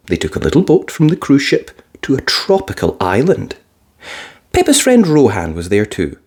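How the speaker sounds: plain reading voice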